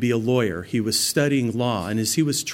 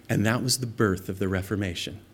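usual speaking voice

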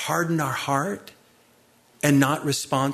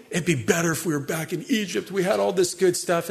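quick, slightly raised grumbling; mock complaining; 4 semitones higher than usual. Preacher imitates Israelites longing for Egypt, light mock grumble. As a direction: grumble like hungry ingrates. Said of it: "they're complaining".

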